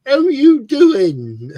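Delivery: high, loud, very drawn-out; slow booming mock greeting; exaggerated greeting. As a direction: stretch it into a big showy greeting. Joins the mock accented greetings.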